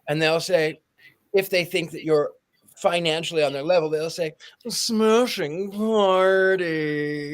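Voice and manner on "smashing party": very slow clenched-jaw posh drawl; Locust Valley lockjaw. Retold or imitated. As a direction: say it through a locked jaw, slowly. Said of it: "the Locust Valley lockjaw".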